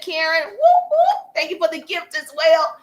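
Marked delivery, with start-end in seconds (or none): 0.5-1.3 high, loud double whoop; celebratory whoop. Real, not acted. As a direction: whoop like a party cheer. Celebrating a gift from a viewer.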